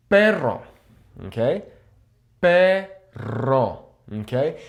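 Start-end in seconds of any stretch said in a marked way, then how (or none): 0.1-0.6 "Perro" with a trilled double R; rolled R. Shown as a demonstration. Viewers asked to say it, trilled "Perro". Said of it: "when you roll the R". 2.4-3.9 "Perro" slow, in two syllables, long trill; dramatic rolled R. Shown as a demonstration. Exercise for viewers, exaggerated trill in "Perro". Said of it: "a little bit more dramatic".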